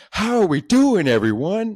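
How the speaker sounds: very high, loud mock waitress yell; over-the-top server shouting a greeting. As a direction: blast a chirpy greeting right behind someone's ear. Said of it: "like, yells in my fucking ear".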